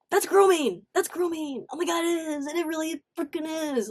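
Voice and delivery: high, indignant exclamation; shocked and indignant, pitch high; outraged. Real, not acted. Outraged at the news report.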